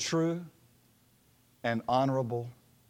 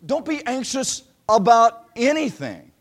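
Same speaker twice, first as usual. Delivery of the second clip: preaching; 6 semitones higher, 7 dB louder than usual. Acted. Preacher quoting Paul.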